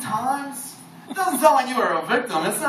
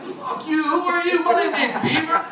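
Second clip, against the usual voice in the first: put-on drunk confused self; 8 semitones higher than usual.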